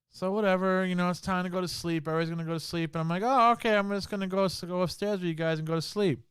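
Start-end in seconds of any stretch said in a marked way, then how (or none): 3.2-6.2 high, loud, mock-sweet agreement; mock cheerful reply. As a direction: play along in an overly bright voice. Retelling his own reply to the family.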